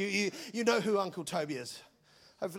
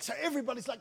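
ordinary delivery

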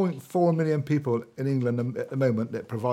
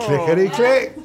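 higher, louder bingo rhyme call; bingo caller; 7 semitones higher, 6 dB louder, slower than usual. Acted. Bingo call for sixty-six.

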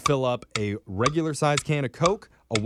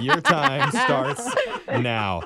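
drawn-out game-show call; 10 semitones higher, much slower than usual. Acted. Host starts the quiz timer again.

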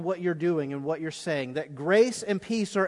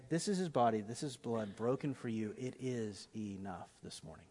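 much softer, lower, near a whisper; hushed communion words; 9 semitones lower, 14 dB quieter than usual. Shown as a demonstration. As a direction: whisper it like a sacred secret. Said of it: "and say".

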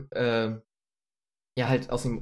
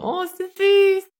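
cooing; 11 dB louder, much slower than usual. Retold or imitated. Cooing over the cute dog.

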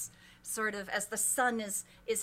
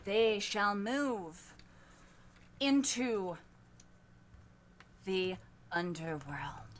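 slow, hushed oracle voice; slow oracle chant with long pauses; prophecy recital; 4 semitones lower, slower than usual. Acted. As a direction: intone each phrase slowly.

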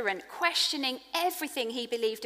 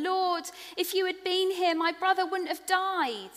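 pleading Mary; 9 semitones higher than usual. Acted. Mary's plea voiced in scripture reading.

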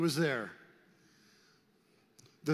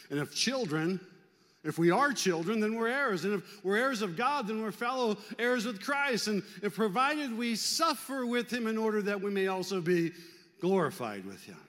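scripture read aloud; 6 semitones higher than usual. Acted. Preacher reads Romans aloud.

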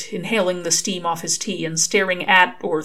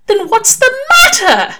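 much higher, louder indignant angel; 10 dB louder than usual. Acted. Angel insists Crowley's idea was good, loud and indignant. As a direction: insist fiercely. Aziraphale presses.

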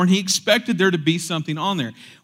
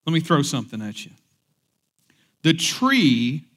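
ordinary talk

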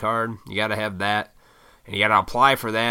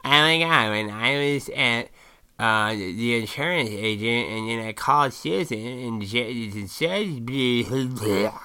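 slurred mock chatter dissolving into babble; mock gossipy blather; slower than usual. Imitating women chatting in groups, mock gossipy blather. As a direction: parody someone's pointless story, drawn out. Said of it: "it's like hot air".

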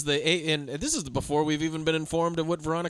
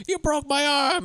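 much higher, yelping; mock pained cry; 10 semitones higher, slower than usual. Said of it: "the guy goes".

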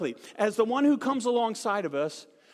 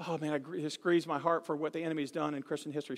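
grieving; 6 semitones lower, 6 dB quieter, faster than usual. Retold or imitated. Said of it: "she started talking like this".

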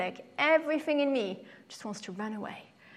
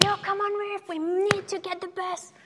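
high, louder insistent friend; 9 semitones higher, 4 dB louder than usual. Retold or imitated. Friend refusing to wait, high and insistent. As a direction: push back hard as the impatient friend. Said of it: "But she was like".

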